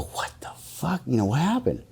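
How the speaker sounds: breathy whispered disbelief; whispered dismay. Retold or imitated. To the cop after the search fails, whispered dismay. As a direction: hush it, stunned. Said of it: "And I go".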